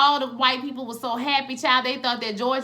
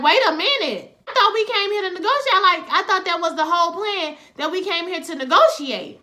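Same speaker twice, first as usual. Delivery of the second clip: 1 high incredulous; 7 semitones higher, 5 dB louder than usual. Retold or imitated. Retold dismay at refusal.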